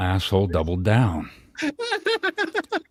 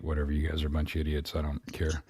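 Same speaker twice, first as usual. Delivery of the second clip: quick, much softer brush-off; dismissive wife; 10 dB quieter, faster than usual. Retold or imitated. Husband imitating his wife brushing off their jokes, quick and soft. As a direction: toss it away like you couldn't care less.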